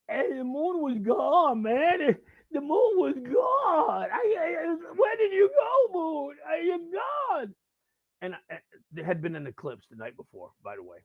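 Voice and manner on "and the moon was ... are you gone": put-on slow, high, loud drunken wail; wasted rocker. Wasted guy telling how the moon vanished, wailing shout. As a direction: howl it out like a distraught drunk. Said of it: "and he's wasted".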